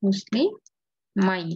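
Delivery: মুসলিমাই split slowly as "muslii... mai"; slow model pronunciation. Shown as a demonstration. Teacher shows how it will be, slow model. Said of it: "এরকম হবে".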